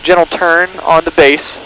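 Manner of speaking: normal talking voice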